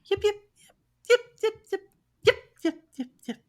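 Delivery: high, sharp yapping of coyote pups; coyote yips. Acted. GM voicing three new coyote pups yipping in the distance, high and sharp. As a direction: yip like young coyotes far off. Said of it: "you hear three yips".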